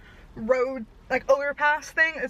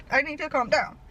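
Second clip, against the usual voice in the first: ordinary talk, nothing marked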